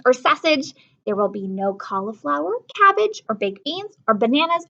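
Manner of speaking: put-on lively read-aloud to children; storybook reading. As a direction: read it like a picture book to kids. Teacher reads a picture book.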